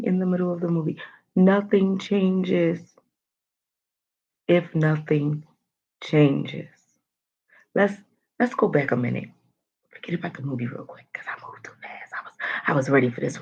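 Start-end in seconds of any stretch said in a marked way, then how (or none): 1.4-6.7 slow, every word stretched; drawn out emphasis. Shown as a demonstration. Host repeating the quote to viewers, drawn out. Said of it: "That's the quote". 9.9-13.4 soft, fast confiding whisper; whispered aside. Real, not acted. Host confessing to viewers she rushed, fast whisper. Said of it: "confiding aside".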